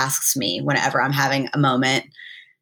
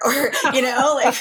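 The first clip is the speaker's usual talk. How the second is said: laughing; 9 semitones higher than usual. Real, not acted. Laughs while quoting.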